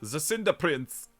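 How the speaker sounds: put-on slow fairy-tale name in a German accent. Savoring the villain's name for fun, German accent. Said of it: "German accent".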